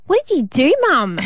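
retold lisping kid voice; lisping little girl. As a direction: imitate a little daughter's lisping question. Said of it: "with her little lisp".

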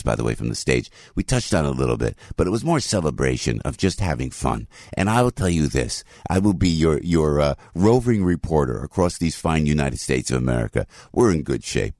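ordinary delivery

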